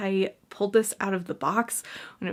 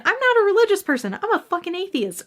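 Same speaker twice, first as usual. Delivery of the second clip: pitched-up fast exasperated outburst; heated exclamation; 10 semitones higher, 4 dB louder than usual. Real, not acted. Ranting to viewers that she's an atheist. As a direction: blurt it out high and quick.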